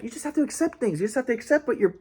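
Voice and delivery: high, soft imitation of grandpa; mock soothing grandpa. Voicing grandpa's advice to accept things.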